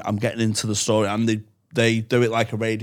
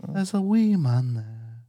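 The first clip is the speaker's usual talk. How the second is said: ASMR voice; slower than usual. Shown as a demonstration. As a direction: lull her to sleep slowly. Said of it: "So I just do that A S M R voice".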